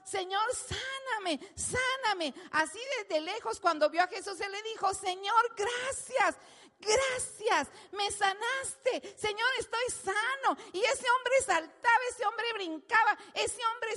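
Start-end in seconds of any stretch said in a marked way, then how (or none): 0.0-2.3 higher, slow pleading cry. Retold or imitated. Leper calls to Jesus from afar, pleading cry. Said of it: "así como gritó". 4.9-10.6 higher, slow, near-crying shout; tearful joyful thanks. Acted. Healed man shouts thanks to Jesus, tearful.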